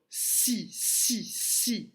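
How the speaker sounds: si repeated alone, short and detached; isolating the syllable. Shown as a demonstration. Teacher to preschoolers, repeating the first syllable si. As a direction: tap out si, si, si like a teacher. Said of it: "si est la première syllabe".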